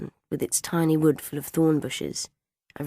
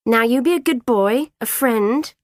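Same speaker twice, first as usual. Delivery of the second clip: high, loud mock-grown-up coaxing; acted patronising singsong; bossy coaxing; 8 semitones higher, 7 dB louder than usual. A narrator's reading. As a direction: talk down to a little boy. Child bossing another in story.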